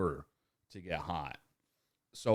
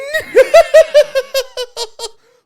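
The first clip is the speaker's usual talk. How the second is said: loud laugh; 26 dB louder than usual. Real, not acted. Laughing after cha-ching.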